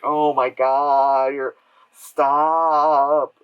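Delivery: loud, very drawn-out protest; exasperated. Acted. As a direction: beg them to stop, stretching each word. Acts exasperation at the characters.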